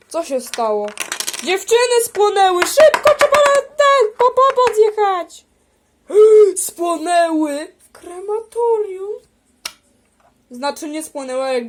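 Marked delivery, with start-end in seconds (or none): 1.4-5.5 high, loud panic calling for help; panicked shout. Pretend. Alarm over girls burned. 6.1-9.1 horrified gasp. Pretend. Horrified at the news.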